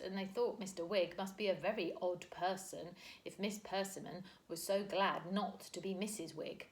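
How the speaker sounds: usual speaking voice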